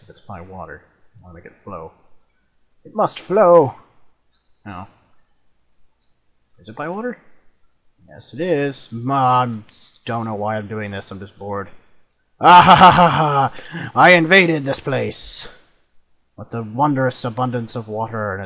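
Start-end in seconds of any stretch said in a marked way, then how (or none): 2.8-3.8 mock grand declaration. Dramatic declaration about water. 12.4-15.5 loud, slow hammed-up maniacal cackle; evil villain laugh. Gamer playing a conquering villain, hammed-up evil laugh and boast.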